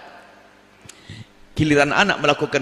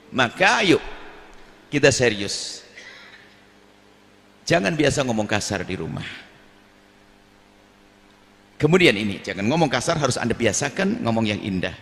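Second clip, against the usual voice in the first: normal talking voice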